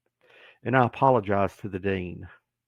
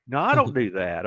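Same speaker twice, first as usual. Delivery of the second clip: ordinary talk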